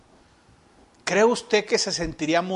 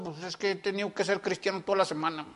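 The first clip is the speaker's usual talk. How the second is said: quick, much softer grumbling answer; sulky complaint; 3 semitones lower, 9 dB quieter, faster than usual. Retold or imitated. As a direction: mumble it like a martyr dragged to church. Acts a sulky churchgoer.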